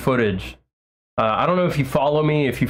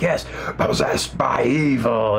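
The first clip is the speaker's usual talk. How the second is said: slow, rasping whisper of the title; eerie whisper; slower than usual. Retold or imitated. Singer echoes song title.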